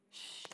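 long quiet "shh"; acted, drawn-out shushing; soft silencing shush. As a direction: hush the voices softly. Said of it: "callen esas voces".

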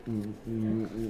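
too quiet. Real, not acted. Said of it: "You're talking too quietly".

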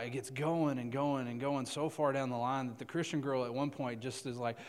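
ordinary talk, nothing marked

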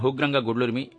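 usual speaking voice

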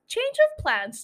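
"Change of plans" jumped way up in pitch; high mock announcement. Shown as a demonstration.

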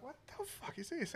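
high, startled disbelief; a mock-shocked squeak of disbelief; baffled outburst. Partners hearing noises next door, high startled disbelief.

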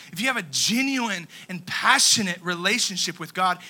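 ordinary talk, nothing marked

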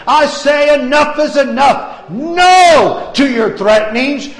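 shouting, high and loud declaration; preaching at full force. Acted. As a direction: thunder it out like a revival preacher.

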